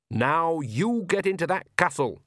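slow, firm growled order; a gentle growl, pushing her to go; mild growl. A narrator's reading. Nanny orders Agnes into the castle, a mild growl. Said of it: "Nanny growled".

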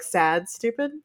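ordinary talk, nothing marked

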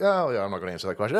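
low, fast, dismissive brush-off; mock dismissive. Showing how a president might dodge a question, dismissive. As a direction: toss it off low and quick. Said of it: "not in a dismissive way, like".

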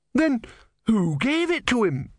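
high-pitched, urgent question; indignant demand. A narrator's reading. Disciple questioning his god, high-pitched indignation.

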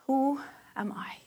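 breathy whispered question. Shown as a demonstration. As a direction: ask it silently, in a hush. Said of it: "See if you can get just one word".

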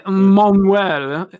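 put-on loud, drawn-out French-accented "Manuel"; attempted French accent. Correcting himself to co-hosts, exaggerated French accent on "Manuel". Copies co-host's French name.

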